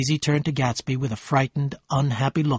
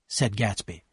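ordinary delivery, nothing marked

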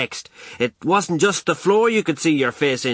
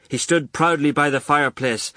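plain reading voice

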